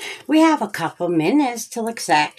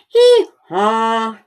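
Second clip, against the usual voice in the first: mock hee-haw, louder; donkey bray; 3 semitones higher, 5 dB louder than usual. Boy brays again.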